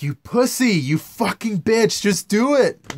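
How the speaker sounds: loud, high furious taunt; mock angry yell. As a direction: yell at the quitter. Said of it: "I'm like".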